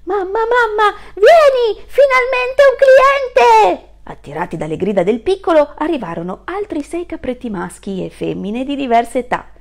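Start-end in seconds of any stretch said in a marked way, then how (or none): 0.0-3.9 put-on very high, loud kid's cry, stretching out the last line slowly; little kid calling his mother; excited little goat shouting. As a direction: squeal for mum like a thrilled child. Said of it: "si mise a gridare".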